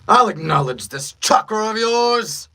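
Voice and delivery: high, loud, slow villain proclamation; grand theatrical villain acknowledgement; villain in battle. Acted. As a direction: build to a drawn-out, powerful finish. Performs villain's line for audio test.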